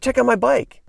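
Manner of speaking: high, proud show-off line; proud Larry. Retold or imitated. Larry showing Chris his BMX bike, high and proud. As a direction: brag a little about the new ride.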